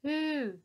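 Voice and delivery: high, loud beckoning hoot; calling mammoth hoo. Acted. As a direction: call her over like a mammoth. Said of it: "beckoned Elsie over".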